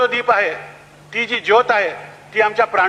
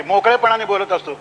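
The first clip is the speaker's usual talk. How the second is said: normal talking voice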